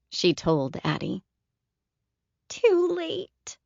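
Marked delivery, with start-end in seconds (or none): 2.5-3.6 put-on high, small, sheepish little-girl voice; a tiny ashamed child admitting it; small chastened child. A narrator's reading. Little Addie confessing to Caroline, small chastened child voice. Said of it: "in a small, chastened voice".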